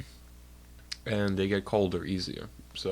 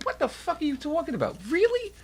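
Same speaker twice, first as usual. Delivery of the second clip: much higher, disbelieving shock; incredulous outburst. Retold or imitated. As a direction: react like the news is absurd. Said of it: "I'm like".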